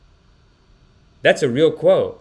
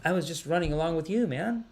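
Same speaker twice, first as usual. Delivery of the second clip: sheepish quiet excuse; 3 semitones higher, 9 dB quieter than usual. Retold or imitated. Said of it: "You're like".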